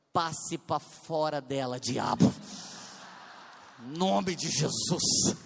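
slow, forceful retold rebuke of the devil; mock exorcism command. Pastor casting a devil out of a woman, commanding.

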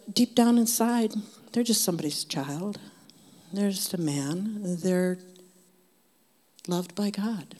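ordinary talk

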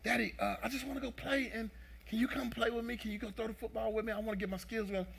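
lightly voiced excited little boy; happy young son. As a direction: imitate a son pleading to play. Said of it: "he comes back".

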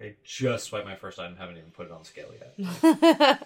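loud, laughing protest; exasperated yell. Retold or imitated. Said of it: "And it's like".

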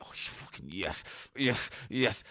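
low, soft, slow ecstatic groan; mock ecstasy; mock blissful moan. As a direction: relish the abuse. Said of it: "He's like".

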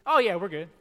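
loud, casual brush-off; breezy brush-off. Retold or imitated. Preacher mimicking someone dodging an apology, loud and breezy. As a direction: wave it away cheerfully. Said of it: "far different from saying".

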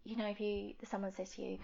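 ordinary talk

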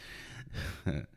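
quiet, breathy laugh to himself; low amused chuckle before explaining; soft chuckle. Real, not acted. Host about to bring up Bill Russell, soft chuckle. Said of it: "I'm laughing because".

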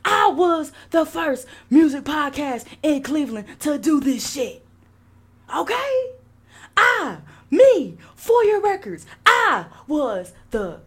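loud, high, indignant boasting. Retold or imitated. Podcaster claiming to be first in Cleveland, hammed-up shouted boast. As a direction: punch each word out as an outraged brag. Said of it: "in my [name] voice".

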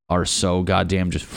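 normal talking voice

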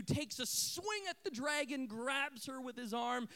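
usual speaking voice

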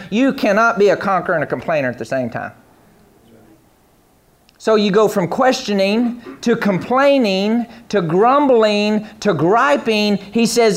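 ordinary talk, nothing marked

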